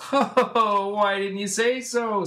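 high, loud, hearty put-on voice; big mock-friendly exclamation; jovial crossing guard. Robot Man answers the boys, booming cheer.

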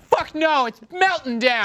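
high-pitched, hammed-up alarm; mock panic. Joking about Norton during the Y two K scare, mock panic. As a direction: play it as a frantic false alarm.

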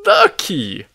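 put-on loud old man's holler; emphatic, exasperated shout. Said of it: "hollers the old man".

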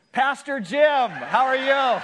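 hammed-up, high, booming hello; sudden over-the-top friendly yell; effusive shouted greeting. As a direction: shout it in mock delight at recognizing your pastor. Man suddenly recognizes his pastor.

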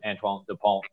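Antoine Dupont said with a light French accent; a quick, half-serious French accent on the name. Shown as a demonstration. Host naming the French scrum-half in his best French accent. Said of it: "my best French accent there".

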